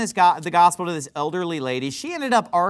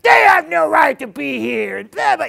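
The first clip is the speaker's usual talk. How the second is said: put-on much higher and louder, screaming like the old guy; imitated furious shout; 8 semitones higher, 7 dB louder than usual. Retelling old guy screaming at elderly lady, imitated furious shout. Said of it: "he's just like".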